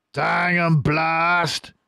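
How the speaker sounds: slow, high, loud shouted curse; Boggis cursing. Acted. Boggis cursing the missed fox, slow shouted. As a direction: bellow the curse in frustration. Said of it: "said Boggis".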